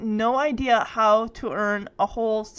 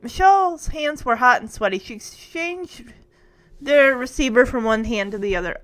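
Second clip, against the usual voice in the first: ordinary delivery, nothing marked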